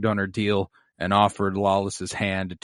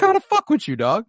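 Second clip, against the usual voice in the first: mock sibling voice; 11 semitones higher than usual. Voicing sibling making peace.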